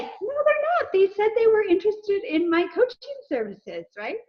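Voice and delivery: high-pitched mock protest to her husband; indignant protest. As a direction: push back high and indignant.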